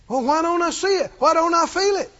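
high-pitched mock doubter's questions; plaintive objection. Preacher voicing a doubter's questions, plaintive objection.

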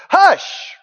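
high, loud hush; sharp hush. Acted. Preacher silencing the fearful voices with a sharp high hush. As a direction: cut them off with a sharp Hush!.

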